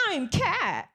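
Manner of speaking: high, dopey self-introduction; mock the coworker up high; impression of Kat. Lawyer mocking the new paralegal with a high dopey voice. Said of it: "a pretty good impression of me".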